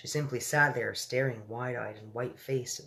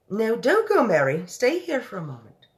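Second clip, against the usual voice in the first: louder, higher pleading queen; urgent Queen; 10 semitones higher, 11 dB louder than usual. Acted. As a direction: beg her to stay, royally. Queen asks maid to stay.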